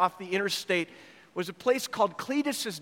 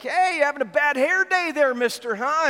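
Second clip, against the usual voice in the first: mocking biker taunt; 6 semitones higher, 4 dB louder than usual. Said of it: "and like".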